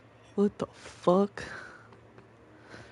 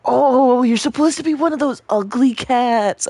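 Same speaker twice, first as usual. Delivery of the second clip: sudden realization; 4 semitones higher, 8 dB louder than usual. Real, not acted. Realizes cat is ugly breed.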